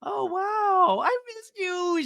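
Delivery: very high, drawn-out coo; squeaky, stretched gushing; mock excitement. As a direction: parody an overexcited greeting. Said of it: "she's so excited today".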